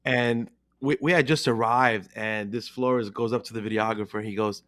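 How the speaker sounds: ordinary delivery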